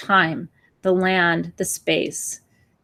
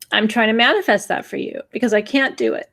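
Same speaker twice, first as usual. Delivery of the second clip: ordinary talk, nothing marked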